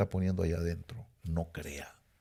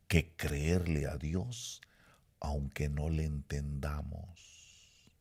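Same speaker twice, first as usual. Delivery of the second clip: soft, slow, confiding counsel; confiding pastor; 4 semitones lower, 6 dB quieter, slower than usual. Retold or imitated. Pastor confides to young Andy, soft and slow. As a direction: lean in and confide gently. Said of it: "voltea y me dice".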